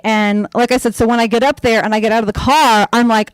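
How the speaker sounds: ordinary delivery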